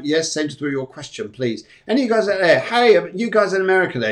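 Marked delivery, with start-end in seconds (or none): 1.9-4.1 raised, higher voice hailing the audience; calling out. Real, not acted. Host calls out to American viewers, bright raised hail. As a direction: call out across the ocean to them.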